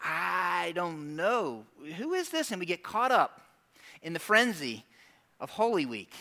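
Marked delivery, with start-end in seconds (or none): 0.0-1.6 slow, drawn-out, softer doubt; unsure churchgoer. Retold or imitated. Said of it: "a whole bunch of us maybe in this room are like". 2.0-2.5 high, soft questioning; puzzled churchgoer. Retold or imitated. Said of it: "maybe in this room are like".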